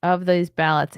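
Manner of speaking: ordinary talk, nothing marked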